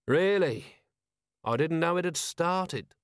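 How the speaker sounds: dry, deadpan sarcasm; mock surprise, bone dry; sardonic. Vimes sardonic.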